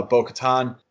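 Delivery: normal talking voice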